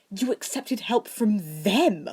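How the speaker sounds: put-on shocked boy yelping at his friend; yelped. A narrator's reading. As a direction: yelp it out in disbelief. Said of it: "Draco yelped".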